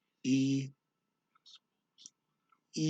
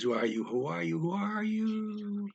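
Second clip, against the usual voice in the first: echo; 7 semitones higher than usual. Acted. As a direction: echo climbing in pitch with each repeat. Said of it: "the echo repeats three times".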